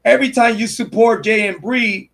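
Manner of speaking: usual speaking voice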